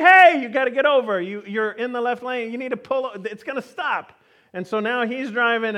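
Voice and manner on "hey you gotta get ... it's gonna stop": high-pitched urgent call, loudest on the opening; acted driver's yelling. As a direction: holler hey, then urge the driver over. Acts out yelling at slow driver.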